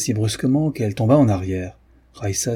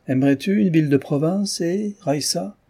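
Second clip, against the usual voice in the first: higher and brighter, lightly cheerful lift; almost joyful; 8 semitones higher than usual. A narrator's reading. Father teasing his daughter about town, almost joyful. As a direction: ask it almost gleefully, voice rising. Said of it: "d'un ton presque joyeux".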